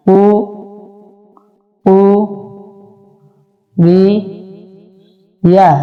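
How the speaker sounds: slow, each syllable broken apart with pauses. Shown as a demonstration. Teacher spelling কুরিয়া slowly for students, syllable by syllable. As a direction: spell কু রি ইয়া out one piece at a time. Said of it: "হরকতের উচ্চারণ তাড়াতাড়ি করতে হয়".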